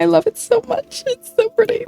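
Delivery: real giddy squeal; gushing delight. Real, not acted. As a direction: gush over it like a new treasure. Gushing over favorite wallpaper.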